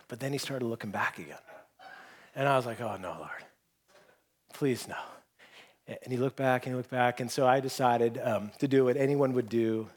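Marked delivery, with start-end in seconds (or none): 2.8-5.0 low, hushed plea to God; small, resigned plea; quiet dread. Retold or imitated. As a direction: pray it under your breath, sinking. Silent prayer when man looks back.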